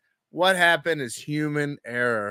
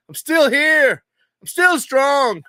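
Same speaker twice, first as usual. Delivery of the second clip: higher, louder, slow rallying cry; defiant declaration; 8 semitones higher, 5 dB louder, slower than usual. Pretend. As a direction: proclaim you're still standing, chin up. Defiant declaration after stream glitch.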